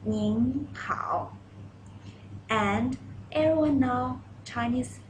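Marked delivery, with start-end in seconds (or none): 0.1-1.3 "Ning hao" said clearly with a falling finish; model greeting. Shown as a demonstration. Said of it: "Please read after me".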